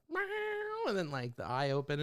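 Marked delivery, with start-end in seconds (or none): 0.1-0.9 high, softer meow; cat meow. Retold or imitated. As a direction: mew like a cat. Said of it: "It was more of like".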